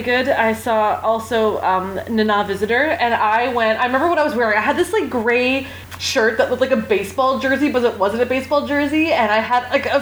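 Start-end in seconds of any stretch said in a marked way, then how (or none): none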